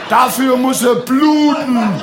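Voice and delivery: high, slow, shouted threat with a laugh; hammed-up bloodthirsty yell; mock fanatic shout. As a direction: bellow it like a raving zealot. The voice demands a murder.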